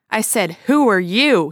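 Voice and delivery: bellowed. Acted. Ed bellows his question again at the stranger, furious. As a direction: bellow it, spitting with rage. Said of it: "he bellowed".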